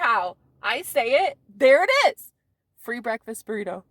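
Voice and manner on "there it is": triumphant. Real, not acted. Triumphant declaration.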